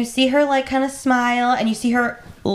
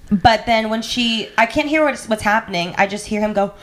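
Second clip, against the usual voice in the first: ordinary delivery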